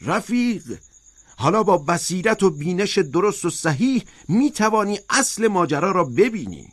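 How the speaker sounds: high, emphatic exclamation; crying out. A narrator's reading. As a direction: cry it out high in triumph at a comrade. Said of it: "اسکویلر فریاد کشید".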